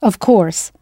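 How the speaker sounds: normal talking voice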